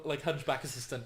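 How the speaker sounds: usual speaking voice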